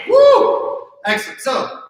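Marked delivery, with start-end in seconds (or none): 0.1-0.8 loud high whoop of triumph; excited cheer. Real, not acted. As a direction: cheer the class on. Cheering as the minute ends.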